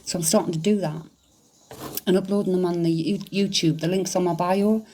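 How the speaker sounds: normal talking voice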